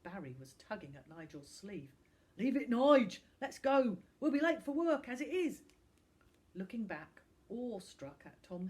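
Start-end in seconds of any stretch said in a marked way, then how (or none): 2.4-5.6 loud, rough, hurrying lout; gruff thug. Acted. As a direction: pull your mate away. Barry drags Nigel away.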